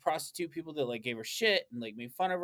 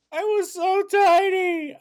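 high, slow, loud squeal of a future self; mock squeal; 12 dB louder, slower than usual. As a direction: squeal it like a stunned older Tatiana. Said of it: "you're gonna be like".

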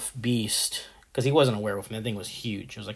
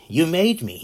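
higher and louder Joker outburst; Joker shout; 6 semitones higher, 7 dB louder than usual. Retold or imitated. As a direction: fling the line out like a crazed villain. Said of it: "like the Joker told Batman".

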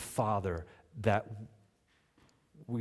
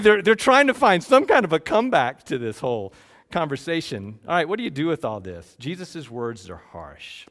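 normal talking voice

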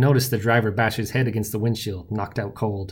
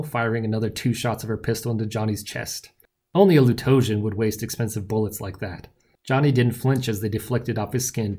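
ordinary delivery

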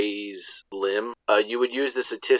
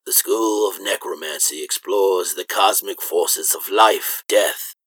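necromancer voice; 5 semitones lower than usual. Acted. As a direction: intone it like a sinister dark wizard. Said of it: "practicing your necromancer voice".

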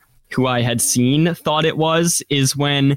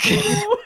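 high-pitched laugh. Real, not acted. Laughing at the drunk line.

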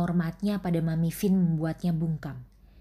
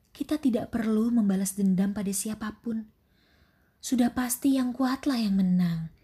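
calm stepmother cockroach; 5 semitones higher than usual. Acted.

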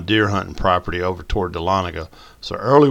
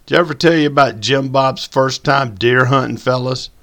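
put-on teasing old man; 6 semitones higher, 7 dB louder than usual. A narrator's reading.

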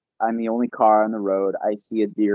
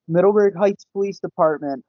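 lightly voiced dispatcher, higher pitch; a hint of a phone-answering receptionist, pitched up; police dispatcher; 7 semitones higher than usual. Dispatcher answering the police line, lightly imitated in higher pitch. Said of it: "She answers the phone. She goes".

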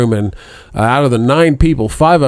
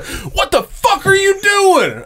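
much higher, slow mock yell; imitating buddy's outrage; much slower than usual. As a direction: play the punched buddy, squeaking out his outrage. Punched buddy yells back at him.

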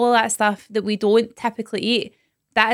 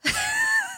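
squealing laugh, much higher; high burst of laughter; 6 dB quieter than usual. Real, not acted. Friends joking about meat, high squeal of laughter.

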